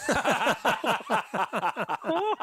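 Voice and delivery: high, loud incredulous laugh; real burst of laughter. Real, not acted. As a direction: break into laughter at the betrayal. Recalling mate's bigger contract.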